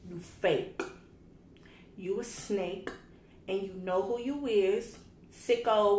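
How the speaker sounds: loud, heated call-out; loud angry call-out. Real, not acted. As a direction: snap it at him like a threat. Calling out the hater.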